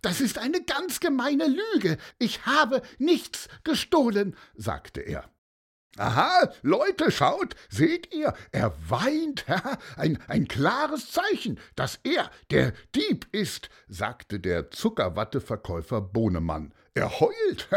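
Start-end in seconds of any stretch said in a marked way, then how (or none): 0.0-4.4 put-on very high, outraged child; high-pitched, indignant wailing; crying with rage. A narrator's reading. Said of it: "fing an zu weinen vor Wut". 6.0-13.7 high, triumphant rabble-rouser; excited, high-pitched accuser; blustering candyfloss seller, gloating. A narrator's reading. Seller stirs up crowd.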